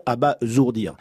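abasourdir said abazourdir, the S voiced as Z. Shown as a demonstration. Guest correcting host on air, abasourdir with a Z. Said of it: "car il faut bien dire Z".

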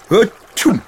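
big, loud acted sneeze; explosive high dragon achoo. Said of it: "Le dragon éternua".

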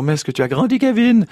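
high, loud character greeting, rising on the name; Marie-Thérèse. Acted. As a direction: exclaim to a little boy, jumping up in pitch on Kevin. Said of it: "elle lui dit toujours".

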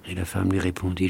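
plain reading voice

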